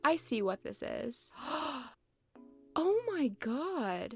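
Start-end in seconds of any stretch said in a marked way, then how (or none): 1.4-4.1 slow, high, drawn-out disbelief; mock shock. Gasping at villagers turning on her, slow and high. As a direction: let the shock stretch out.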